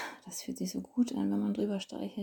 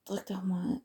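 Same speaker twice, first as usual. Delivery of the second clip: ordinary delivery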